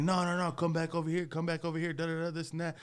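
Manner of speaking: calling after him. Retold or imitated. Guy calling him back.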